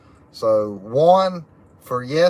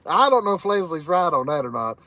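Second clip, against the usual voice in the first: normal talking voice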